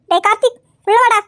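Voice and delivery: loud, bossy calling out; stern call. Acted. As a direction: call him inside like a cross elder. Calls the boy inside sternly.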